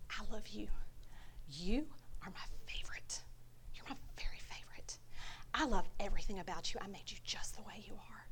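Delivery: put-on hushed and intimate, as to a beloved child; tender whisper. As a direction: whisper love straight into their face. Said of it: "he takes your face and he says".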